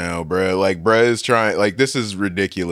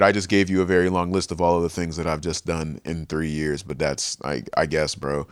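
trailing off quietly; 6 semitones lower, 6 dB quieter than usual. Retold or imitated. Son's reply trailing off.

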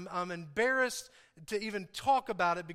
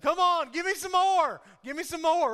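loud, high-pitched goading for more; loud mock demand; 7 semitones higher, 8 dB louder than usual. Preacher acting out someone asking for more to carry, loud and eager. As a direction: call out boldly, as if taking on any load.

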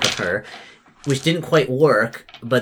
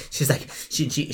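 ordinary delivery

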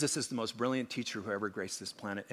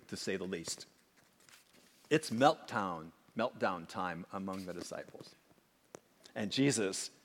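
normal talking voice

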